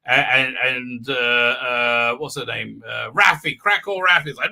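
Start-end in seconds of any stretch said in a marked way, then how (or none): none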